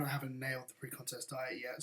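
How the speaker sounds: ordinary delivery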